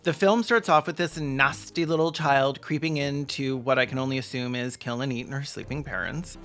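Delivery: ordinary talk, nothing marked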